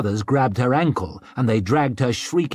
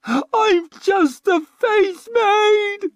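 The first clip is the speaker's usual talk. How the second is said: very high, loud, very slow crying shriek; a high, drawn-out sobbing shriek; 5 dB louder, much slower than usual. A narrator's reading. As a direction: wail it in desperation. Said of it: "she shrieked".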